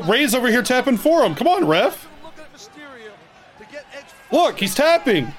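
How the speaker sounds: pleading shout at the ref; yelling at the tv. Real, not acted. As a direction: urge the referee from the couch. Urging the ref while watching.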